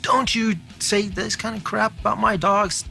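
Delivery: high, sharp retelling of a sudden blowup; retold outburst. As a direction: play the dog owner snapping back, pitched high. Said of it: "Dave just came unglued".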